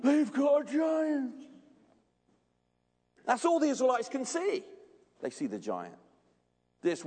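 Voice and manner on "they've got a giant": high, slow mock-horrified cry; drawn-out imitation of aghast rivals; awestruck schoolboys. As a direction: gawp aloud like a stunned kid. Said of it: "I can still picture their, their mouths going".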